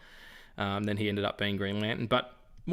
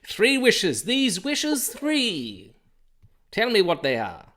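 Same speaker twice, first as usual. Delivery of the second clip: much higher, louder booming genie; 10 semitones higher, 5 dB louder, slower than usual. Acted. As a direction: grant wishes like a theatrical genie. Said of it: "I sound like a genie".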